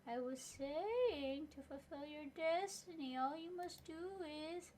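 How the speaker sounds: high, slow, otherworldly prophet voice; ghostly prophet. Acted. Hallucinated spirit delivering a prophecy, slow ghostly voice. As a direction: intone it like a spirit in a vision.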